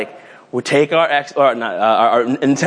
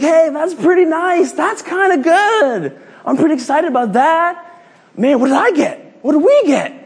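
put-on high, bouncy kid voice; a kid hoping for his own gift; eager kid; 10 semitones higher than usual. Preacher voicing a kid eager for his present, high-pitched. Kid eyeing others' gifts.

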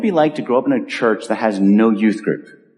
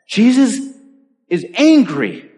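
ordinary talk

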